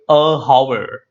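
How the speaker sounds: "a hour" with the H sounded, "a howver"; mispronounced hour. Shown as a demonstration. Teacher showing what we don't say, "a hour" with spoken H. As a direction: breathe a full H into "hour" after "a". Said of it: "we don't say".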